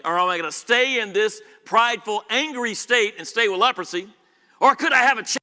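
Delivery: loud, rising pulpit build; preaching at full force. Acted. Preacher pressing the crowd about Naaman's choice, loud and rising. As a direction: drive the choice home to the congregation.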